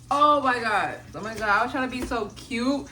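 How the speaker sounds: ordinary talk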